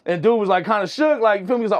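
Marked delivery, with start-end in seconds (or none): none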